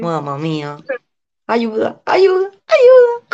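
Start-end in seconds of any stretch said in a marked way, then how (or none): 1.5-3.3 cries of help rising far higher; a hammed-up call for help; mock plea for help. Pide ayuda en broma. (petición de ayuda exagerada en broma)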